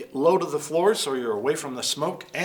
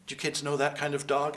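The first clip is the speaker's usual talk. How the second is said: plain reading voice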